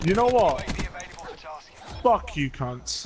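drawn-out furious curse at enemies; snap, then drag out the insult; raging gamer. Real, not acted. Gamer raging after being killed.

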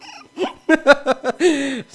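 loud, high trailing laugh; can't stop laughing; real laugh. Real, not acted. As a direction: laugh that fades out. Laughing after long pause.

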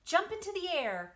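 high, loud call; loud high cry of command. Acted. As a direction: spider crying out to the pig. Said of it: "cried Charlotte".

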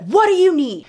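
loud, high hostile demand; angry boss. Retold or imitated. Boss turning on Nikki at the door, loud angry demand. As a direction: bark it at whoever opened the door. Boss snaps at intruding narrator.

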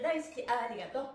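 usual speaking voice

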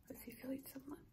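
hushed unvoiced whisper of adoration; adoring whisper. Real, not acted. Owner gushing over her puppy in a whisper. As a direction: whisper it like he's asleep in your arms.

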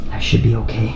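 normal talking voice

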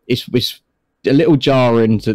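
ordinary talk, nothing marked

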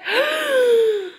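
high laughing gasp; a laughing gasp of gratitude; grateful gasp. Retold or imitated. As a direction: gasp in touched surprise. Moved by gift.

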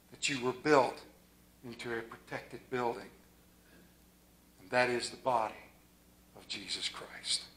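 quiet pastoral close; soft closing exhortation. Real, not acted. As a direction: lead them gently into prayer.

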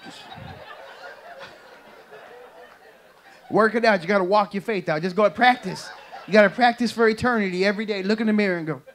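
rally the church, exhorting loudly; preaching at full force. Acted.

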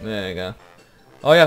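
usual speaking voice

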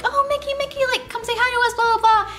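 high, sing-song fan voice calling out; gushing guests. Retold or imitated. Guests at tables begging Mickey to come say hi, high gushing call. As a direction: coax Mickey over like eager fans. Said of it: "guests at tablers are like".

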